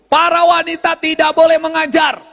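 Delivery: hammered-out verse quote; emphatic proclamation. Acted. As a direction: proclaim the rule forcefully, all on one high note. Quotes verse against women pastors.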